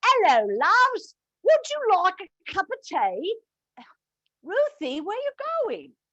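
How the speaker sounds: put-on light Cockney accent, cheerful; a hint of a Cockney barmaid. Greeting customers as Mildred, in a lightly voiced Cockney accent. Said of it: "I spoke with a Cockney accent".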